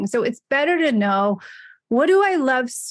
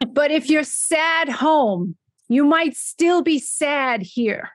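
slow, higher Long Island drawl; Sandler's slow Long Island voice; Long Island accent; 3 semitones higher, slower than usual. Retold or imitated. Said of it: "Long Island accent".

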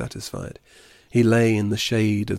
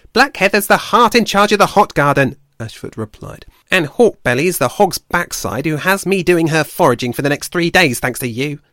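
much higher and louder, huffy and blaming; indignant squirrel; 7 semitones higher, 8 dB louder than usual. Acted. Ashford blaming Blue Tongue for her punishment, indignant. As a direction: let her bristle with resentment at him.

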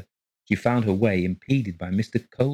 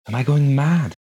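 frightened wonder; 7 semitones higher, 6 dB louder than usual. A narrator's reading. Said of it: "she wondered".

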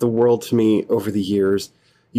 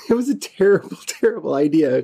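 laughing; 6 semitones higher than usual. Real, not acted. Laughs recalling bad start.

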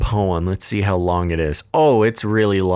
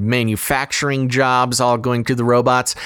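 usual speaking voice

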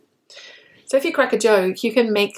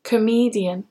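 Comedian stressed on ME; stress on middle syllable. Shown as a demonstration. Teacher models comedian for learners, middle syllable stressed. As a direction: lean on ME in Comedian. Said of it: "the stress is on the middle syllable".

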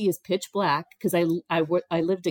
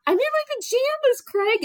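squeaky, much higher, louder cry of alarm; flustered squeal; 5 dB louder than usual. Retold or imitated. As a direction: yelp it out, mortified at being caught in pajamas. Me concerned that I'm in my pajamas.

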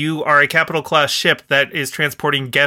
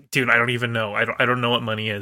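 softer, fast, throwaway mumble; mumbled mock Riker; 4 semitones lower, 5 dB quieter, faster than usual. Riker brushing off the financier, softer and fast. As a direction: rush it out like a clueless shrug. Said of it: "Riker just is like".

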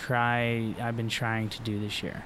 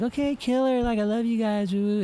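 much higher, chirpy mock cheer; cheesy cheerleader; 11 semitones higher than usual. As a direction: gush like an over-the-top cheerleader. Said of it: "It's like".

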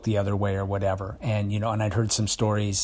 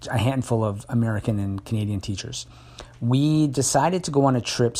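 normal talking voice

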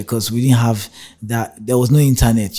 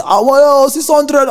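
shocked shout; 8 dB louder, much slower than usual. Retold or imitated. Haggling with bus conductor.

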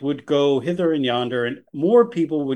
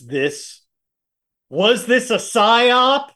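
dramatic; 7 semitones higher, 3 dB louder, much slower than usual. Pretend. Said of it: "dramatic conspiracy question".

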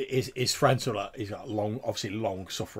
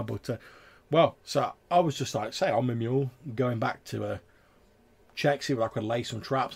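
louder rustic trapper, rambling on; chatty tavern tale-teller; 4 semitones higher, 7 dB louder than usual. Acted. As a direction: ramble like a country trapper with a yarn.

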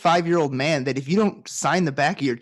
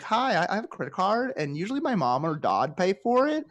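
put-on higher-pitched clueless young customer; mock uptalking customer; 5 semitones higher than usual. Customer asking bank worker about fees, mock uptalk. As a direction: imitate uptalk, lifting the phrases into questions. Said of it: "exactly the women that Tad was just talking about".

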